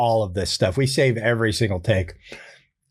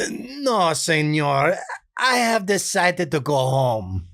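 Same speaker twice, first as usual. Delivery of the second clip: put-on high, slow pleading Spanish-accented plea; a nice guy begging off in a thick Spanish accent; hint of dubbed Spanish accent; Benito voice; 4 semitones higher, slower than usual. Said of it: "Benito's voice".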